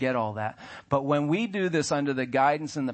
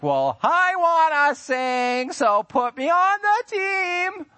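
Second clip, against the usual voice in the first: much higher, louder and very slow whiny demand; entitled church member; 6 dB louder, much slower than usual. Pretend. Pastor mocking a member who demands the worship team, whiny and high. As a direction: whine it out like a spoiled volunteer.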